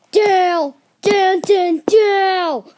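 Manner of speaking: loud, high repeated shout; loud calling out. Pretend. Kid playing, ordering down over and over, shouting. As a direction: call each word out high and loud.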